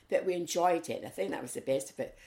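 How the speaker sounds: ordinary talk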